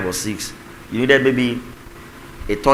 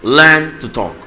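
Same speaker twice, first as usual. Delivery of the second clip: Learn to talk shouted, louder, higher; loud command; 3 semitones higher, 6 dB louder, slower than usual. Real, not acted. Preacher commanding the congregation, loud and emphatic. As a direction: preach it out with force. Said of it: "Tell your neighbor".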